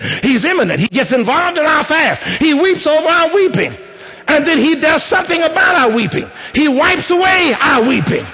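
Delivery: high, rising preacher's build; preaching at full force. Acted. Preacher telling the church Jesus was angry, rising preaching. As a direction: climb in pitch, pressing the point home.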